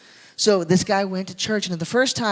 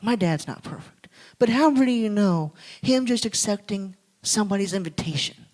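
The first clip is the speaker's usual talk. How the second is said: ordinary delivery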